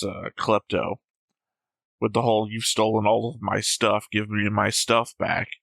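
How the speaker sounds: ordinary talk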